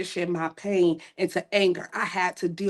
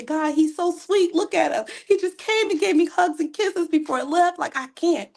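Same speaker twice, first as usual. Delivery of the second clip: pleading; 11 semitones higher than usual. Retold or imitated. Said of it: "And I would say".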